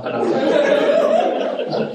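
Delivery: high, slow, laughing through the words; real laughter breaking up the line. Real, not acted. Pastor cracking up retelling his kids' story, laughing.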